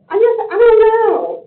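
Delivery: real crying, words falling apart; breaking down. Real, not acted. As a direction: voice cracks up high and loud in tears. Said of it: "I get emotional".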